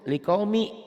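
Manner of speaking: likaumi read with one vowel, no N at the end; high demo of likaumi without the N; N dropped. Shown as a demonstration. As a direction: say LIKAUMI ending on a plain "mi". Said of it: "Baca satu".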